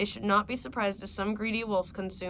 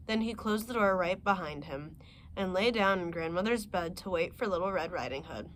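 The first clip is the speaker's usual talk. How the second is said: ordinary delivery, nothing marked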